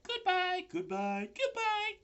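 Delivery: very high spider goodbyes; little spiders calling from the wind; tiny voices calling. A narrator's reading.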